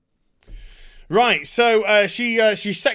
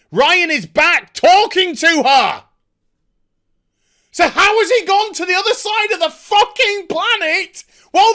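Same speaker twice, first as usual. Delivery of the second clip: exasperated rant; 10 semitones higher, 7 dB louder than usual. Real, not acted. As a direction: rage at lazy writing. Angry rant at the writers.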